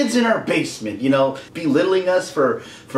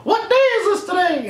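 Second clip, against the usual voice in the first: much higher frantic cartoon voice; panicked Mickey; 13 semitones higher than usual. Mickey waking from nightmare, high and frantic. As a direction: wake up gasping in cartoon panic.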